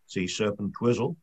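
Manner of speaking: ordinary delivery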